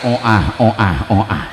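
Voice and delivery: husband copying the lisp on oah; says w for r. Retold or imitated. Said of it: "aing jadi melu cadel".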